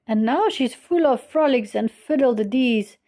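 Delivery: usual speaking voice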